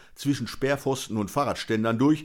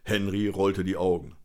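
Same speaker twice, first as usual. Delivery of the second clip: plain reading voice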